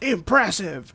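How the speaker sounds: over-the-top villain exclaiming; hammed-up Green Goblin. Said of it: "he's like".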